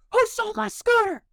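high retold demand, yelled; yelling. Shown as a demonstration. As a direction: shout it at the whole street. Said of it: "I yelled at these people like that".

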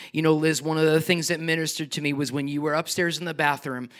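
ordinary delivery

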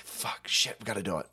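unvoiced whisper of panicked curses. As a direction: hiss it under the breath.

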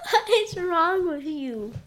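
laughing through the question. Real, not acted. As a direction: giggle while asking. Laughing at partner's voice.

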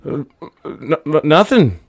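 stall, then blurt it guiltily; nervous denial. Acted. Boy hiding a stolen vial, nervous stammer.

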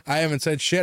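ordinary talk, nothing marked

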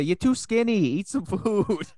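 put-on high, slow Italian auntie accent. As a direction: fuss in an Italian mamma accent. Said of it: "You were doing an Italian accent".

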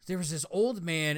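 usual speaking voice

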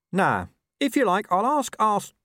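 a hint of a streetwise dog; scruffy dog voice. A narrator's reading.

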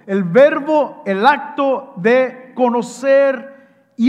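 loud, driving pulpit preaching; fervent preaching. Acted. Preacher drives home his point to the congregation, fervent and loud. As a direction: preach it with full force.

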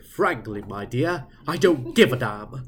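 put-on crisp theatrical old-movie delivery; old Hollywood diction. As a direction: declaim like a classic film star. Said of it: "see these old actors just going and talking".

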